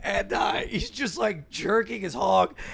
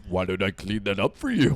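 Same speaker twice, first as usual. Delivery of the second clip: RoboCop; 11 semitones lower than usual. Retold or imitated. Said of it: "RoboCop deep voice".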